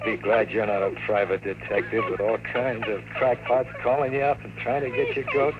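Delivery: ordinary delivery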